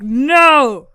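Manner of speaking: loud, drawn-out shouted no; hammed-up yell; mock outburst. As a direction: belt out an exaggerated refusal. Retelling Homer's outburst.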